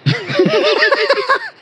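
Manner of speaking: a shrill burst of giggling; high squealing laugh. Real, not acted. Laughing at the polygamy chapter, high squeal.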